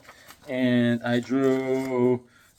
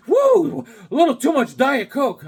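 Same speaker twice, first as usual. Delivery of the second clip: high, loud "woo" into chatty excitement; high-pitched mock excitement; imitated whooping stranger; 12 semitones higher, 6 dB louder than usual.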